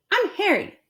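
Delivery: put-on high boyish voice; boy voiced. Harry introducing himself, high boy voice. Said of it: "said one boy".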